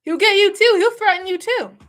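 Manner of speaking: loud emphatic warning. Real, not acted. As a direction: warn them hard and loud.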